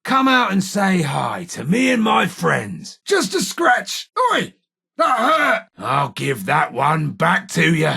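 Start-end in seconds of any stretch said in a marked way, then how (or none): 3.0-5.7 high, indignant yelp; pained outcry. Acted. Gunfighter hit by an enemy, crying out high. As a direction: yelp back after taking a hit.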